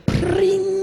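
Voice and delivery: very high twangy guitar noise; mock power chord. Imitating bands stepping on a power chord, high twang. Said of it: "step down on a power chord".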